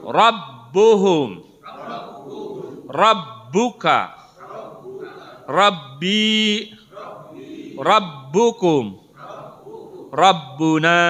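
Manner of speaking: slow, loud, drawn-out words for the class to repeat; slow drill recitation. Shown as a demonstration. Teacher leading the class to read the words together, slow and loud. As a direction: lead the class word by word, slowly and loudly. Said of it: "Kita baca bersama dulu".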